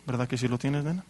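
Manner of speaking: usual speaking voice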